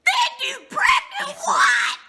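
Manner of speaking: real disbelief, yelled out; shocked shout. Real, not acted. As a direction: viewer shouting at the news. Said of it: "I fell forward and I said".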